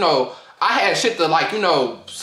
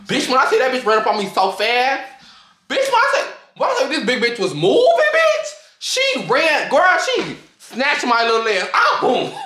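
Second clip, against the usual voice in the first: excited retelling; 7 semitones higher than usual. Retold or imitated. Telling friends how the big girl charged, laughing excitedly. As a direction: pitch shooting way up, laughing through it.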